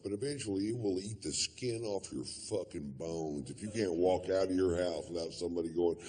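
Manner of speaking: ordinary delivery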